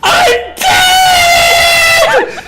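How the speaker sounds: very high, loud, wailing shriek; shrieking ghost. Acted. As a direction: scream it to the rafters in despair. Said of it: "he shrieks, very, very loudly".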